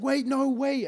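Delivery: put-on high pleading call of a drunk woman; calling lady. Mimicking the drunk lady calling after them, high pleading voice.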